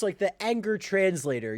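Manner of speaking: ordinary talk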